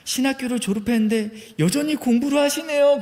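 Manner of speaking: high, loud booming auntie voice; booming Jeolla lady. Retold or imitated. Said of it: "쩌렁쩌렁하게". (높고 큰 쩌렁쩌렁한 아주머니 목소리)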